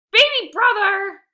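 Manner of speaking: loud, high, slow angry yell. Acted. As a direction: bellow it at the little brother. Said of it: "yelled Lexi".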